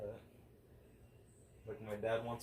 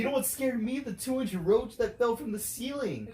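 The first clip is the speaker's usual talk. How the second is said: high, loud indignant comeback; loud retort; 13 semitones higher, 10 dB louder than usual. Retold or imitated. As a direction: throw it back at her, pitched up and loud. Retorting to mom after roach.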